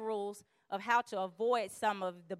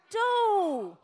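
exasperated dude; 10 semitones higher, 7 dB louder than usual. Real, not acted. Exasperated remark.

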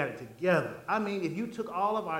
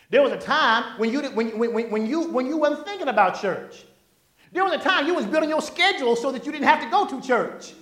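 rising, louder preacher's build; preaching; 6 semitones higher, 6 dB louder than usual. Acted. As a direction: push it harder line by line like a pulpit build.

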